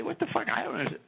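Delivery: high, squeaky mock bafflement; baffled outburst. Retelling his confusion at Derek's foreign speech, mock baffled. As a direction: pitch it way up as if totally lost.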